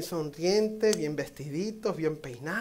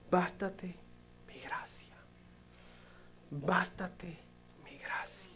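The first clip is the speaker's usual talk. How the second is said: much softer, whispered words of God; breathy whisper; 16 dB quieter, slower than usual. Acted. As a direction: whisper it as God speaking tenderly. Quoting God's words softly.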